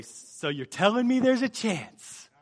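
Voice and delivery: hammed-up comedy quote; Dumb and Dumber quote. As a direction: draw it out high, like a goofy film character. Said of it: "Dumb and Dumber, right?".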